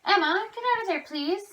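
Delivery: high-pitched call to the dog across the room. Real, not acted. Owner calling her dog away from the cat's food, high call.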